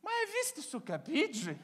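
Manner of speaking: high Italian mom, scandalized; whisper-gossip the insult in Italian; Italian mother gossip. Retold or imitated. Mother insulting after door closes.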